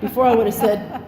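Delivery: ordinary delivery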